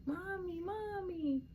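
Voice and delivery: put-on high whining kid calling mommy; whiny child voice. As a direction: whine like a small child. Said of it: "here comes your".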